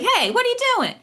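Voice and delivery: high-pitched indignant call-out; mock yell. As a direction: act out yelling at a stranger who cut in. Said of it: "You might yell at them".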